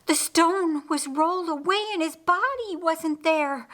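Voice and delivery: high, breathless rush of news; high breathless panic. Acted. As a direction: blurt it out still winded. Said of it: "she gasped between breaths".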